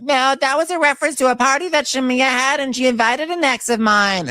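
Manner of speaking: very high protesting voice; mocking Portia. Portia defending her text, high mock protest. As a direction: squeak out a defensive excuse. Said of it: "Portia's like".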